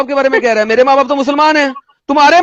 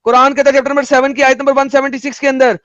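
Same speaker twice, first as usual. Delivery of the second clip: usual speaking voice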